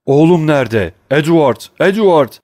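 loud high panicked cry; sudden cry. Acted. Father suddenly crying out for his son, loud panic.

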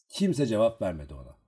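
usual speaking voice

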